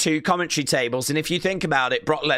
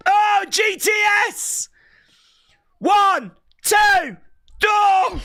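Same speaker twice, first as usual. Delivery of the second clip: much higher, louder yelling call; excited shout; 4 dB louder, slower than usual. Real, not acted. Fan calling the GTS and the count, shouting. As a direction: yell the move and count it out.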